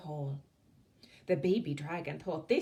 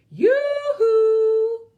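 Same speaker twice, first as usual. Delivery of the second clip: high, loud long call; 15 dB louder than usual. Acted. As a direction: call across the night. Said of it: "Winnie called again".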